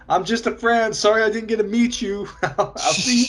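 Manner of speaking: high-pitched imitation of calling out; mock yelling. Man calling after the guy walking off with her, mock yelling. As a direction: yell it in jest, pitched well up. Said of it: "He just starts yelling at the guy".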